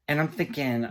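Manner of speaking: normal talking voice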